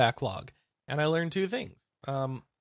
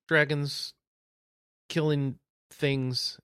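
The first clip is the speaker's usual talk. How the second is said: ordinary talk, nothing marked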